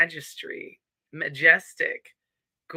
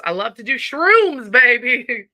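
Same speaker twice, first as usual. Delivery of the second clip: loud, higher, jokey whoop; mock party cheer; 5 semitones higher, 9 dB louder than usual. Host joking to viewers about mushroom blend, loud mock excitement. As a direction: belt it out like a pretend party animal.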